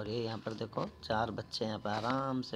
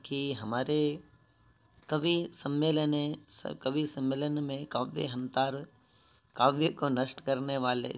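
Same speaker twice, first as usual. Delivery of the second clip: louder recited Sanskrit with quick Hindi glosses; louder Sanskrit reading; 2 semitones higher, 4 dB louder than usual. Shown as a demonstration. Teacher re-reading the Sanskrit for pupils, louder. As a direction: read it out again, stressing the Sanskrit.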